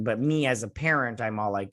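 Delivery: ordinary talk, nothing marked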